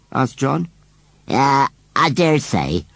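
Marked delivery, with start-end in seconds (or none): 1.3-2.9 slow, higher, offhand reply; indifferent. A narrator's reading. Scarecrow answers whether it's a boy, indifferently. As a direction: drag it out as if uninterested. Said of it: "replied Gummidge indifferently".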